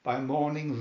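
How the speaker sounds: ordinary delivery, nothing marked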